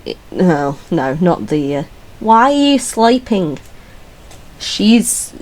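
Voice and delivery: ordinary delivery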